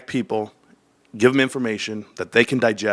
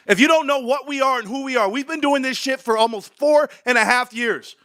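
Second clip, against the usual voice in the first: furious, much higher ranting at the camera; heated rant; 11 semitones higher than usual. Real, not acted. Streamer ranting to viewers about GameStop, furious high-pitched rant. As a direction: rail against Wall Street, voice pitched way up. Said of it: "I'm extremely animated".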